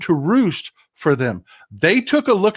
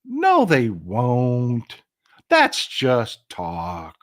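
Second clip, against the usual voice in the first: dismissive mock; 3 semitones lower, 3 dB quieter, much slower than usual. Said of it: "the whole feeling from way too many people was".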